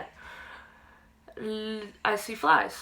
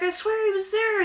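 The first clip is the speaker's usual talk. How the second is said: put-on insistent kid; 11 semitones higher than usual. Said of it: "I was like".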